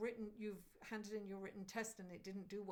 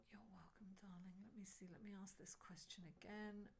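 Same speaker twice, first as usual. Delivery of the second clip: soft whisper to herself; 11 dB quieter than usual. Real, not acted. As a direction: breathe the words, barely voiced. Quiet aside while shuffling cards.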